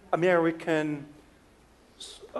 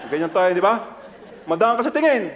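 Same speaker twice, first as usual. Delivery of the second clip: normal talking voice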